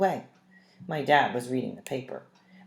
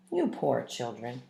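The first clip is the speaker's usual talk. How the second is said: slow, lower pitying tone; sympathetic dad; 3 semitones lower, slower than usual. A narrator's reading. Dad pitying his children who have no milk. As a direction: gently pity the kids. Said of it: "he said".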